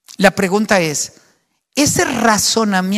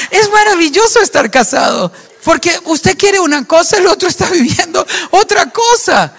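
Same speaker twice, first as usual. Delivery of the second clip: amused; 8 semitones higher, 4 dB louder than usual. Real, not acted. Joking about married life.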